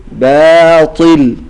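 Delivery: باطل very slow with a heavy thickened baa; باطل drawn out, the baa said full and heavy; correct pronunciation. Shown as a demonstration. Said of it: "تقول باطل". (نطق صحيح لكلمة باطل بباء مفخمة ممدودة)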